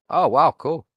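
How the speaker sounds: sarcastic mock enthusiasm. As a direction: fake impressed, dripping sarcasm. Sarcastic reaction.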